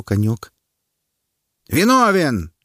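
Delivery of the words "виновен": high, loud verdict cry; crowd shout. A narrator's reading. A loud onlooker shouts the verdict. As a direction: bellow guilty from the crowd. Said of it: "крикнул особо голосистый".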